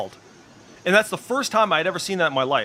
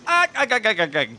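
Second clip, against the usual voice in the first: high, louder mock sailor chuckle; Popeye's laugh; 5 semitones higher, 4 dB louder, faster than usual. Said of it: "He goes".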